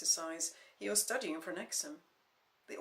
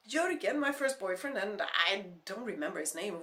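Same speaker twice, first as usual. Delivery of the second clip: defiant; 6 dB louder than usual. Acted. Defiant listing.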